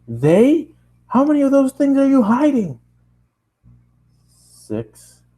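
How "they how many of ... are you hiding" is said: hammed-up upset dad calling his son; angry dad voice. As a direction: play the furious father on the phone. Dad scolding on phone.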